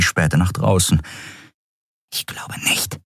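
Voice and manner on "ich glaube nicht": soft, voiceless whisper; hushed answer. Acted. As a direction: whisper back while keeping out of sight. Lookout answers while hiding. (leise geflüsterte Antwort)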